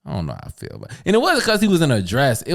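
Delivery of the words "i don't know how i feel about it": low, soft and hesitant; quietly doubtful inner voice; unsure mumble. Retold or imitated. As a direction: mutter it to yourself, undecided. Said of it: "I was like".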